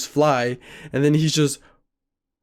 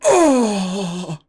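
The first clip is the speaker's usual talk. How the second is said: one long, higher moan; imitated last moan; 5 semitones higher than usual. Friend lying on the floor, imitated last moan. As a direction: let out a final drawn-out groan. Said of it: "last moan".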